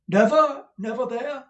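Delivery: high, loud disbelieving repeat; stagey astonishment at the answer; the manager can't believe it; incredulous manager. A narrator's reading.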